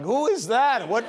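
high-pitched mock bewilderment; startled pigeons. Pigeons spotting a man on a hoverboard, startled. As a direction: act out baffled pigeons, pitch jumping up. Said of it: "The pigeons were like".

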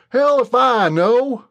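slow, loud, lifted Southern retort; Brent, defiant drawl. A narrator's reading. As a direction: throw it back at him, cornered. Brent snaps evasively.

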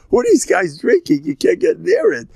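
high, loud, scandalized squeal at the wine; a shocked old man recoiling from a sip; appalled disbelief. Retold or imitated. Old man mocks young people's dry wine, high appalled squeal. Wife and him mock young drinkers.

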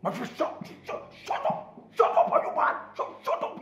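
explosive, stuttering shouts; furious outburst. Acted. Friend explodes at Joy's demand, furious. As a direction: silence them with bursts of outrage.

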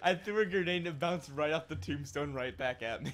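laughing through the words; laughing while talking. Real, not acted.

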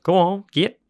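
loud, high shout at the dog; yell. Acted. Junkyard man yelling his dog away, loud shout. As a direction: shoo the dog off hard. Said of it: "slapped his dog's butt and yelled".

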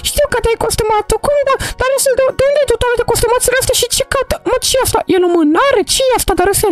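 put-on high, worried little girl asking why he cries; a young girl pleading with a crying friend; girl character voice. Mara asks crying Darusel what's wrong, high worried voice.

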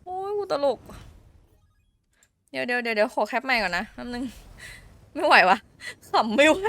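laughing fit between words; giggling, trying to stop laughing; breaking character. Real, not acted. (หัวเราะไม่หยุด)

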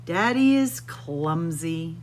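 ordinary delivery

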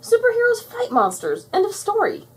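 loud, high-pitched protest; indignant yelling. A narrator's reading. Buttercup insisting superheroes fight monsters, yelling. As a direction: argue hotly, punching each phrase. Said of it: "Buttercup yelled".

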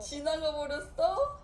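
put-on high surprised echo for a toddler; mock surprise. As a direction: echo back in playful surprise. Mother echoes with surprise.

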